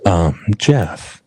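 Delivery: low, close-mic ASMR voice; mock ASMR, low and intimate; playful ASMR. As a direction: murmur right into the microphone, lingering between words. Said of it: "not the A S M R".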